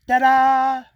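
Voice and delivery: high, loud mock ta-da, presenting a mess; sarcastic showman's reveal of bad work; mock fanfare over shoddy work. Mocking the sloppy insulation job.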